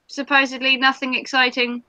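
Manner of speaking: normal talking voice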